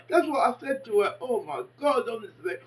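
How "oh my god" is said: ordinary talk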